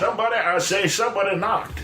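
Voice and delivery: high, blustering Southern senator voice; Senator Claghorn. Retold or imitated. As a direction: boom it like a pompous Southern senator. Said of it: "That was Claghorn".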